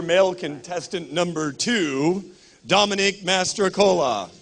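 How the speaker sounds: announcer voice. Acted. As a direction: boom it out like a ring announcer. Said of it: "This is my announcer voice".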